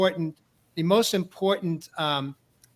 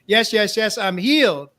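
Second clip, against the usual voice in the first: raised, exultant mock scream, kept short of full volume; retold scream; 3 semitones higher, 7 dB louder than usual. Screaming affirmations alone out in the wilderness, retold as a restrained yell. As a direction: imitate screaming an affirmation, louder than your usual talk. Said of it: "I'm screaming things like".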